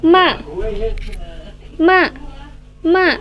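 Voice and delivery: stamp out "Ma" as if cross; stamped fourth tone. Shown as a demonstration. Teacher demonstrates the fourth tone like an angry person, "Ma" falling sharply. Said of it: "nada keempat itu dihentakan ya".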